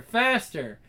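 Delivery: ordinary delivery, nothing marked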